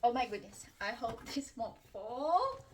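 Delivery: ordinary talk, nothing marked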